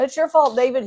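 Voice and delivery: mock angry crowd yelling at David; accusing crowd. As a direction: blame him like an angry mob.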